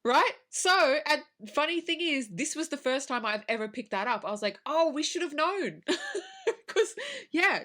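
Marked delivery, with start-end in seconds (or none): 4.6-7.6 high-pitched, breaking into laughter; laughing realisation. Real, not acted. Podcaster telling co-host about finally catching the clue, laughing. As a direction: laugh at having missed the clue.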